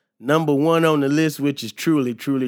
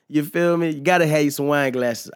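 ordinary talk, nothing marked

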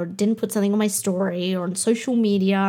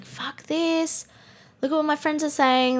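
imitating giving up; 6 semitones higher than usual. Imagined self quitting over friends' words, high and defeated. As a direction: sigh it out as if quitting. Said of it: "But just imagine if I was like".

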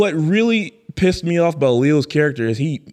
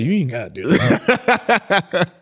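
drawled scolding; 4 semitones higher, slower than usual. Retold or imitated. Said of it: "him and Robert De Niro like".

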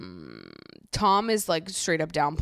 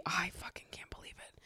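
breathless disbelieving whisper; 14 dB quieter than usual. Real, not acted. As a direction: hush it like a secret. Aside after big reveal.

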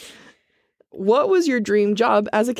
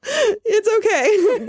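mock crying; 14 semitones higher, much slower than usual.

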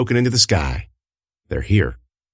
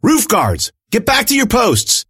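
urgent shouted command; shouting orders; 13 semitones higher, slower than usual. A narrator's reading. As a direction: bark the order across the roof. Alani orders guards over radio.

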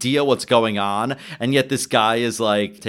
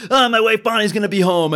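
fast, higher, louder mock panic; panicking; 7 semitones higher, 4 dB louder, faster than usual. Jimmy fearing his wife comes home, fast mock panic. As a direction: blurt it out like a man about to be caught. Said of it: "the three of them are kind of panicking".

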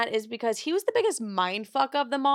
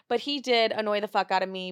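normal talking voice

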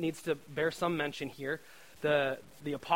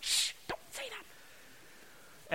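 whispered, much higher and slow; hushing whisper; 8 dB quieter, much slower than usual. Retold or imitated. Apostles hushing whoever mentioned the boy's food, whispered shush. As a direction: hiss it as a secret shush.

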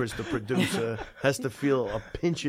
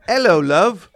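hammed-up British accent, higher and much louder; mock British accent, second try; 6 semitones higher, 14 dB louder, slower than usual. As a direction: try the British greeting once more, hamming it up. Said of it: "Let me try it again".

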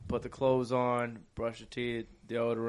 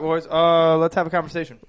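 usual speaking voice

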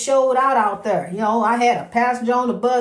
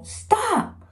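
high urgent yell of stop; hollering; 12 semitones higher, much slower than usual. Retold or imitated. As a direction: yell at the cat to stop, pitched way up. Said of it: "he's out there hollering at Kyler".

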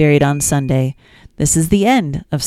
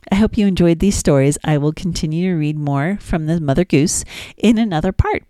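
ordinary talk, nothing marked

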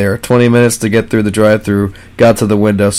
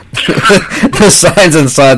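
laughing; 9 semitones higher, 4 dB louder, much slower than usual. Real, not acted. Laughing while reading review.

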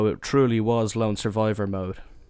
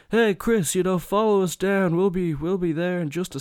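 higher, louder call across the club; shout; 9 semitones higher, 4 dB louder than usual. Retold or imitated. To friend Chris across the dance floor, a higher, louder shout. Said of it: "And I shout out".

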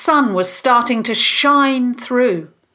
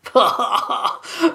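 short scornful villain laugh; mocking laugh; villain's laugh; 3 semitones higher than usual. A narrator's reading. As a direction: laugh dismissively at the challengers. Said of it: "The Lord of the Frost laughed".

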